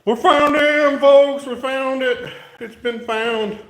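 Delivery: very high, loud hammed-up celebration; mock-triumphant announcement. Builder tells viewers the missing part turned up, hammed-up excitement. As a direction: cry it out in a silly high voice, easing off at the end.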